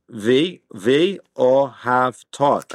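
"V, O, have taught" broken into separate syllables, weight on "O" and "taught"; stress as marked. Shown as a demonstration. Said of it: "stress on the pronunciation of that".

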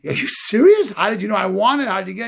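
high, incredulous rush of questions; amazed sister. Retold or imitated. Sister seeing the gold ring from her brother, amazed. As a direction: imitate a girl stunned by a gift.

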